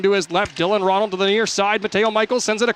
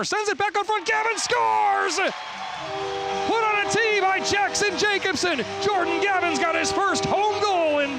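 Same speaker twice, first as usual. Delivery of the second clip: excited goal call; 10 semitones higher, 4 dB quieter, slower than usual. Real, not acted. Goal call in hockey broadcast.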